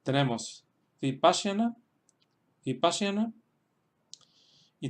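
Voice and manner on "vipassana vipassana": "Vipassana" accented on PAS; careful demonstration of "Vipassana", PAS stressed; stress on second syllable. Shown as a demonstration. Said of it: "ahí se queda el acento".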